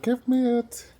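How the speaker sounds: dog's voice. Pretend. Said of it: "He's like".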